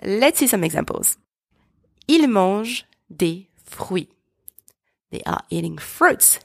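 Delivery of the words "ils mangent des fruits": slow model French, "mangent" as "monzh"; silent ENT. Shown as a demonstration. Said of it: "the letters E N T are silent".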